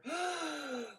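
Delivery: sharp high gasp after a long dive; big gasp for air. Acted. Seal bursting out of the water, desperate gasp for air. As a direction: gulp in air like you nearly drowned.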